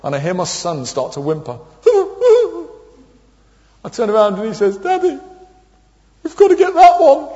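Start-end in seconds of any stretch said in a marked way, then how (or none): 1.8-2.7 put-on child whimper. Son starts to whimper. 4.8-7.3 put-on high, whiny little boy voice; pleading child. Little son pleading with his dad, high and whiny.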